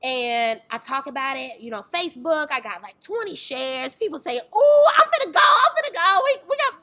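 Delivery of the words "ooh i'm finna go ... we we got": high, loud mock-eager squeal; excited hype. People promising to come to the dance tour, excited. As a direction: gush like the keenest fan. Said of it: "yeah, I'm excited".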